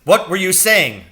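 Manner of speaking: loud, high interrogation; sharp shouted question; angry demand. Acted. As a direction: demand an answer at once. Teacher interrogating the boy.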